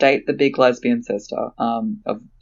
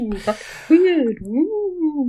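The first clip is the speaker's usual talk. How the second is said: much higher, slow ghostly moan; much slower than usual. Acted. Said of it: "keep doing ghost sounds in the background".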